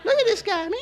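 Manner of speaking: high falsetto imitation of coworkers; mimicked coworkers. Coworkers eyeing the hardworking newcomer, mimicked in falsetto. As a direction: put on a high, amazed voice. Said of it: "Ustedes saben cómo van ... los morenitos".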